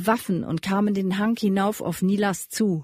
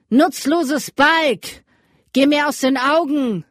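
contemptuous dismissal; 6 semitones higher, 6 dB louder, slower than usual. A narrator's reading. Rider dismisses the sobbing boy.

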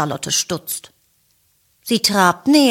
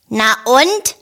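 grumpy Ferdinand; 5 semitones higher, 3 dB louder than usual. A narrator's reading. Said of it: "knurrt Ferdinand".

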